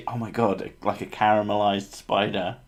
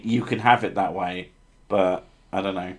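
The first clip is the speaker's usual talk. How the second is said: ordinary delivery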